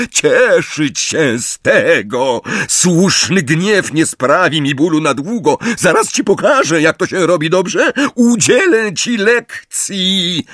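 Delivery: high, slow villainous goading; taunting lecture. A narrator's reading. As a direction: lecture an opponent with menace. Villain lectures Harry mid-duel.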